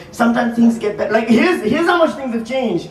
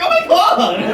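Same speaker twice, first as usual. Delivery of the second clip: high, thrilled exclamation; delighted gasp; 7 semitones higher, slower than usual. Retold or imitated. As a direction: sound thrilled. Delighted reaction.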